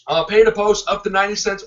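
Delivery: ordinary delivery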